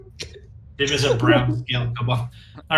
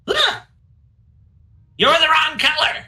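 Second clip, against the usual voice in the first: high, squeaky little kobold; shrill little accuser, pitched way up; 13 semitones higher than usual. Acted. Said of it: "He goes".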